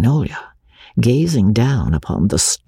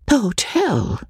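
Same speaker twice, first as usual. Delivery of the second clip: dismayed cry; 9 semitones higher than usual. Acted. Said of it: "cried Magnolia".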